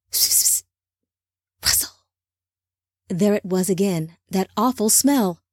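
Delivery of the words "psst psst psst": unvoiced psst, psst, psst; hissed psst sounds. A narrator's reading. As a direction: hiss it like something stirring. Rustling sound effect in story.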